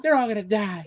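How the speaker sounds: retold kid panicking about the movie, mock distraught; distraught little kid. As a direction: play your scared six-year-old self. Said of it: "I was distraught".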